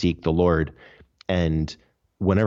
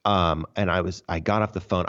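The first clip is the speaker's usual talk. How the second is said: ordinary delivery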